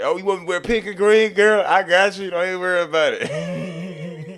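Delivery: high, loud mock-eager compliance; eager agreement. Acting out eager agreement to wife.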